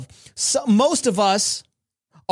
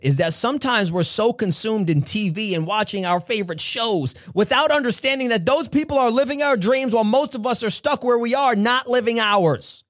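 normal talking voice

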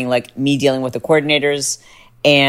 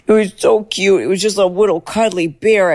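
higher, cooing over a cuddly animal; gushing coo; 6 semitones higher than usual. Real, not acted. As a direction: gush like seeing a puppy. Gushing about the bear.

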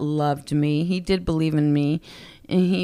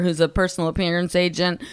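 ordinary talk

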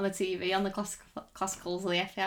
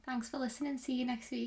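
soft, high-pitched Scouse attempt; higher, quieter mock Scouse; Scouse accent; 4 semitones higher, 4 dB quieter than usual. Shown as a demonstration. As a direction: sign off in put-on Scouse, pitched up. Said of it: "Always go so high pitch".

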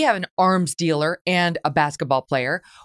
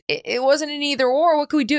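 high, dismissive mock shrug; shrugging it off; 9 semitones higher than usual. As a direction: toss it off like there was nothing to be done. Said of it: "basically shrugs his shoulders and say".

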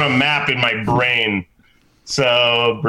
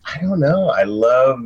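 usual speaking voice

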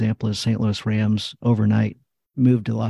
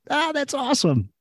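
squeaky, much higher voice for a mock-thrilled reaction; mock delight. Answering the receiver's wife, in a high mock-delighted gush. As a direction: pop it way up high like a cartoon gush.

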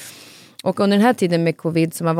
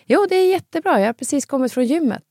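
put-on energetic old dad; 8 semitones higher than usual. Father back from the gym telling his daughter, energetic and higher. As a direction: bounce like a proud eighty-year-old fresh from the gym. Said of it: "han har sån energi i rösten".

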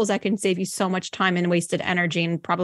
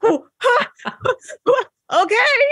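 stunned laughing huh; 12 semitones higher than usual. Retold or imitated. Guest recalls the guide's dope slap, shocked laughing huffs. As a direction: gasp out a shocked half laugh after the slap. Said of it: "And I was like".

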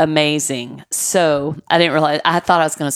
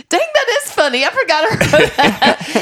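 laughing; 12 semitones higher, 3 dB louder than usual. Retold or imitated. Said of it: "He's like".

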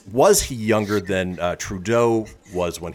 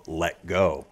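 ordinary delivery

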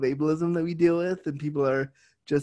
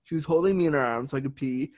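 ordinary talk, nothing marked

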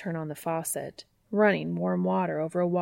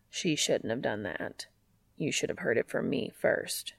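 deep Castiel voice; 6 semitones lower, 5 dB quieter than usual. Acted. Castiel's voice in story.

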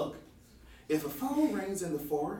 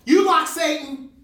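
loud, higher, slow accusing yell; loud accusation; 8 semitones higher, 10 dB louder, slower than usual. Acted. Park ranger accusing the hiker of liking Satan, loud. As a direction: bellow the accusation.

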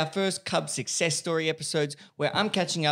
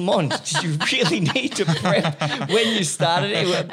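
slow, louder teasing through laughter; laughing tease; 4 semitones higher, 6 dB louder, slower than usual. Retold or imitated. Host laughing while teasing guest about prepping. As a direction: tease her, laughing as you ask.

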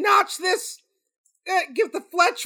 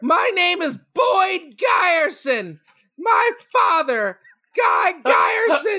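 hammed-up tearful shout; mock sobbing; slower than usual. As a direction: sob it out, overplayed grief. Grieving son confronts director.

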